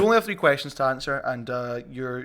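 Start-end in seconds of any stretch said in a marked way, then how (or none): none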